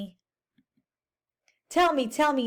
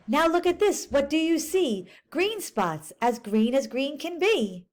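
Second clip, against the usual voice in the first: lively picture-book read-aloud; teacher reading a storybook aloud to kids; reading to children; 3 semitones higher than usual. Acted. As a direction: read it to the class, bright and clear.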